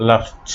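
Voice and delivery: "লাফড" said as "laft"; final -ed as T. Shown as a demonstration. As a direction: close "লাফড" on a voiceless t. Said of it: "ইডির উচ্চারণ হবে ট". ("লাফড"-এর শেষে ট উচ্চারণ)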